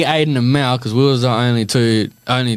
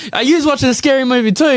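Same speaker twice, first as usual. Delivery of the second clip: alarmed coach; 9 semitones higher, faster than usual. Retold or imitated. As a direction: burst in like a shocked coach. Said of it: "She comes in".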